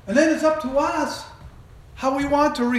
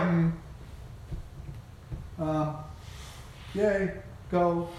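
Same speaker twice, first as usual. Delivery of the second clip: mock unenthusiastic; 8 semitones lower, 10 dB quieter, slower than usual. People unenthused by your plan.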